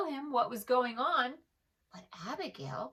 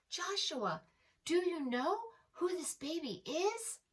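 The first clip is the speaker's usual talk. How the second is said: surprised shepherds; 8 semitones higher than usual. A narrator's reading. Shepherds surprised to find lamb.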